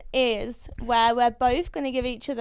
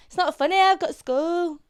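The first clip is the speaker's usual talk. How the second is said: broad Yorkshire meme line; 4 semitones higher than usual. Retold or imitated. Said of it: "Where it goes".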